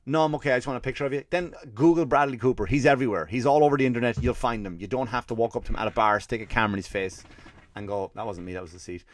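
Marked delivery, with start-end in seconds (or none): 0.0-1.2 fast dismissive fan. Retold or imitated. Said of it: "and you go". 8.1-9.0 much softer mumbled aside; quiet aside. Real, not acted.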